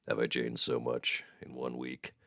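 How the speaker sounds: low, soft seductive voice; mock sexy voice. Streamer proving his sexy sick voice remains, playfully sultry. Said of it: "Sick, sexy voice [name] is gone".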